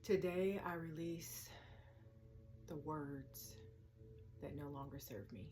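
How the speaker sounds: soft affirmations with long pauses; quiet, unhurried meditation voice; guided meditation. Acted. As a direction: speak each release gently, then let it settle. Leads a release meditation.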